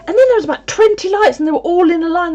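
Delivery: excited retelling. Retold or imitated. Excited about the lights.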